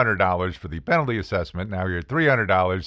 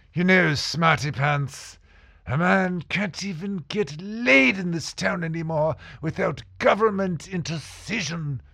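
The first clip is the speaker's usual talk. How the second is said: mocking judge voice; 8 semitones higher than usual. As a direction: play the judge as a pompous know-it-all, pitched up. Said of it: "The judge looks at me and says".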